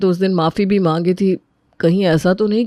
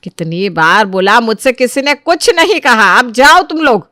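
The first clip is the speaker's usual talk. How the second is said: exasperated; 3 semitones higher, 3 dB louder than usual. Acted. Mother insists nobody told her anything, exasperated.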